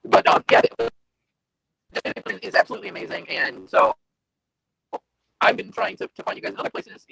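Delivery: glitchy robotic audio; choppy digital robot sound. Real, not acted. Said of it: "you sound like a robot right now".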